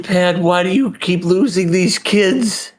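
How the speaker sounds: high-pitched, exasperated complaint; mock exasperation. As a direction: scold a whole country in mock despair. Mock scolding of a trope.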